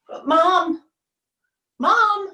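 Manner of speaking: put-on child calling.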